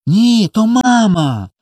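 put-on high, drawn-out childish insistence; insistent assurance. A narrator's reading. Little boy assures his brother it is mama, insistent. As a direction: insist loudly and slowly, pitched high. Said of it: "завіряв Коля".

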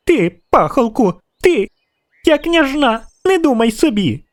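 put-on very high, imperious child voice; acted indignant girlish squeal; squealing princess. A narrator's reading. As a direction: pitch it way up as an outraged little princess. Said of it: "досить цього вереску".

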